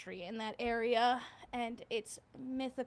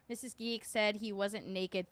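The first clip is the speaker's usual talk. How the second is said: usual speaking voice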